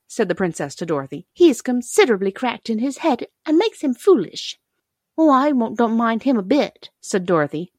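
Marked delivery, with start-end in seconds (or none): 1.3-4.6 high-pitched princess voice; dainty china princess. A narrator's reading. 5.2-6.9 lightly voiced girl, higher pitch; a hint of an earnest little girl; friendly young girl. A narrator's reading.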